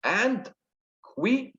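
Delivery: high, separated rising beats; syllable by syllable. Shown as a demonstration. Teacher counting four syllables for students, syllable by syllable. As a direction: count out "And quivering" in pieces. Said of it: "Here is four syllables".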